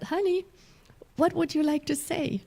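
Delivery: put-on sweet motherly question to a child; tender to inner child. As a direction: gently ask like talking to a small child. Tenderly asking her inner child.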